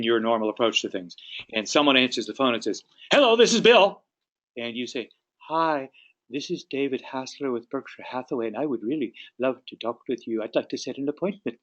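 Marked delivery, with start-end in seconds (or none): 3.1-4.0 high, louder phone pickup; loud phone greeting. Acted. Client picks up the agent's call, loud and high. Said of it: "let's say you're a fairly soft-spoken person". 5.5-11.6 soft-spoken. Acted. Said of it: "you're a fairly soft-spoken person".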